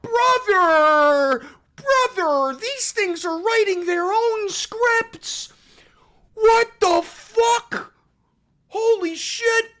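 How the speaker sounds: high, loud, slow disbelief; incredulous yelling. Real, not acted. Streamer hollering at a chatter who thinks AI is scripted.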